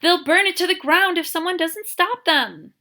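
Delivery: loud high frantic plea; urgent and frightened, pleading; panicked. Acted.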